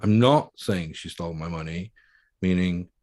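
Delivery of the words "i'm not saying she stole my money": "not" punched hard; firm denial landing on "not"; stress on NOT. Shown as a demonstration. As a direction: hit the word "not", rest unstressed. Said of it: "word you emphasize".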